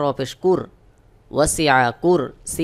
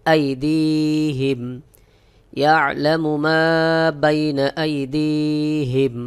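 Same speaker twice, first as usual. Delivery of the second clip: slow chanted recitation; teacher models the verse in slow tajweed; Quranic recitation; much slower than usual. Shown as a demonstration. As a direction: recite it slowly and melodiously.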